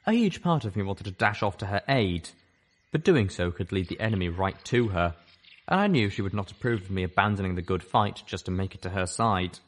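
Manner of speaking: plain reading voice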